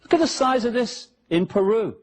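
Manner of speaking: ordinary delivery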